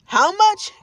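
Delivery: high, loud shocked question; shocked gasp. A narrator's reading. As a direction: gasp out the price in disbelief. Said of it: "gasped Dad".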